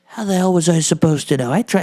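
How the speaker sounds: usual speaking voice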